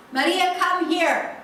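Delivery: father summoning his wife with a stern, loud slow shout; angry shout. Retold or imitated. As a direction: call her sharply like a furious father. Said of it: "shouts at Ele".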